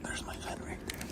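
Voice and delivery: breathy hushed whisper; whisper, staying hidden. Real, not acted. Hiding in freezer at night.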